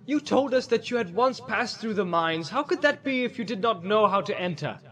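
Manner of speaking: high, pressing character voice; challenging. Acted. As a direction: question him in rising indignation. Boromir challenges the wizard.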